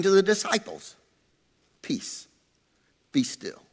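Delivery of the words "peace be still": very soft, low, gentle command; almost breathe the words peace, be still; hushed calm. Acted. Jesus soothing frightened disciples, very soft and hushed.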